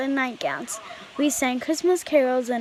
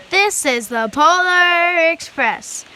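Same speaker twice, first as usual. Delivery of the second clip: slow, louder, higher proud proclamation; grand announcement; 6 semitones higher, 9 dB louder, slower than usual. A narrator's reading. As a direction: present the train with pride. Conductor announces train name.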